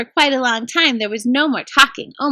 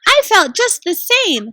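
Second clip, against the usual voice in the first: higher, slow breathless voice; a touch breathless and high; 7 semitones higher, 4 dB louder than usual. Acted. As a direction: gasp it out, shaken. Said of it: "in a breathless voice".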